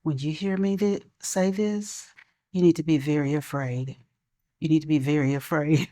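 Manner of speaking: put-on hushed, low warning to a child; soft and ominous, barely above a murmur; quiet parental warning. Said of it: "I would talk to him in a very quiet voice".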